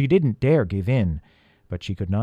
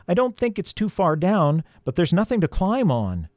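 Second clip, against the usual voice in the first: higher, raised answering voice; calling up; 7 semitones higher than usual. A narrator's reading. Elf on the ledge answers Peter, calling up.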